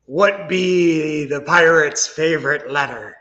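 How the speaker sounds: slow pirate growl. Pretend. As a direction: ham it up like a pirate. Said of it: "I get to do a pirate voice".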